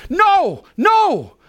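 high, loud refusal; lash out. Retold or imitated. Preacher voices people lashing out at God, high and loud. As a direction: push help away like a stubborn child. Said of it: "we sometimes go".

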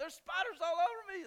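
high, loud panicky cry; mock panic. Husband imitates his wife's spider panic, high shrill cry. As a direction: squeal like spiders are crawling on you. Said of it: "she's like".

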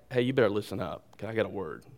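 low, quick, quiet warning; firm warning. Acted. Preacher voices Jesus cutting in on Simon, low and firm. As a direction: pull someone up with understated firmness. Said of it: "This is like".

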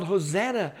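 high, ringing retold cry; retold crowd cheer. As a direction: proclaim it like a welcoming crowd, short of a yell.

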